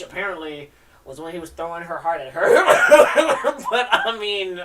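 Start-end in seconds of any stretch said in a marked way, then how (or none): 2.5-3.7 high loud real laughter; big laugh. Real, not acted. Reader laughs at his own heart-throwing joke, loud. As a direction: burst out laughing.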